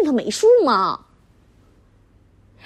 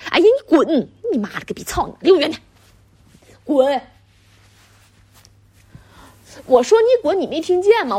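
irritated outburst, rising higher as it goes; angry rebuff; 3 semitones higher than usual. Acted. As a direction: drive him away in disgust. Wife tells husband to get away. (厌烦地怒斥)

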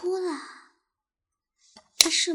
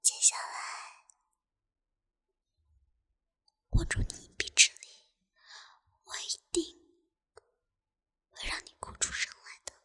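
menacing whisper; 4 dB quieter than usual. Acted.

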